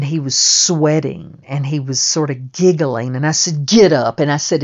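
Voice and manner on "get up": louder, barked order; loud command. Retold or imitated. Telling the beaten man to get up, loud command. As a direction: order him up off the floor.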